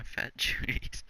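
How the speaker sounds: talking through a laugh. Real, not acted. Podcaster giving a silly shout-out, laughing. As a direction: crack up partway through the words. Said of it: "I don't know why I'm laughing".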